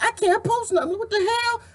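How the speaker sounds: high exasperated squeal; retold frustration. Retelling being blocked from posting, high and exasperated. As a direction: up high, like Facebook blocked you. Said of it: "I was like".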